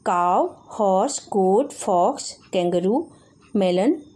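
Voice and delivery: slow, careful reading of काऊ, horse, गोट, fox one by one; slow word list. Shown as a demonstration. Teacher reading the word set to children, slow and careful. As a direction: spell out each animal name slowly for the class. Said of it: "टू".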